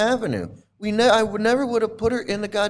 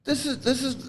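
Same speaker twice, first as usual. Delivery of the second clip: voice breaking; 4 semitones higher, 5 dB quieter than usual. Real, not acted. Upset father, voice breaking.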